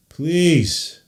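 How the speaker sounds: high, loud, drawn-out plea; loud pleading. Real, not acted. Streamer begging the broadcast to return, loud plea. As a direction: beg for the game to come back.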